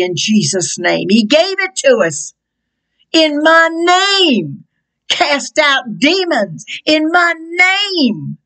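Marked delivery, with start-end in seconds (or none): 3.1-8.4 high and slow proclamation. Acted. Preaching Jesus' words emphatically.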